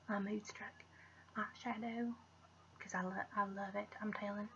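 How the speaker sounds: soft confiding whisper. Real, not acted. Vlogger whispering about her favourite eyeshadow. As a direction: whisper it like a little secret.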